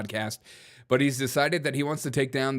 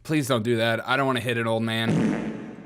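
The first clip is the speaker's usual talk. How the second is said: ordinary delivery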